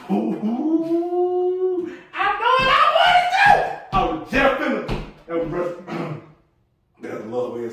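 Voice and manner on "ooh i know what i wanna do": tempted self. Retold or imitated. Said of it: "I got some".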